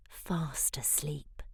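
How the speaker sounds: hushed, slow whisper. A narrator's reading. Narrator whispering as creatures sneak up, slow hush. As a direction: whisper so the lizard won't wake.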